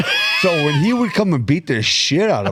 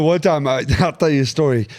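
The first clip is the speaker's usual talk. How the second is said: usual speaking voice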